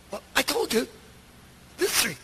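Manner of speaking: lightly mocked exasperated retort; imitating a short-tempered answer; tone of attitude. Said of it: "this tone of voice now".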